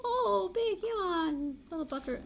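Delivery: high, slow coo at a yawning dog. Real, not acted. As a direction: coo over the sleepy pup.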